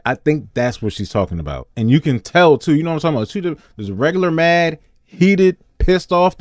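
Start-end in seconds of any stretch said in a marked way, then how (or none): none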